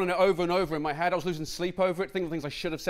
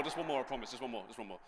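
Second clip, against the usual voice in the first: trailing off softer and softer; fading plea; 5 semitones lower, 12 dB quieter than usual. Real, not acted. Comedian pleading for one more go, fading murmur. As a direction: beg quietly as it fades out.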